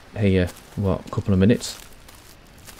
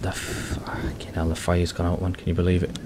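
whispering; 6 dB quieter than usual. Real, not acted. Fire gone out, exasperated.

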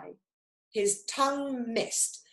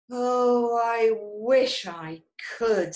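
tag wishful; 3 semitones higher, 6 dB louder, slower than usual. A narrator's reading. Chameleon wishes he could change colour.

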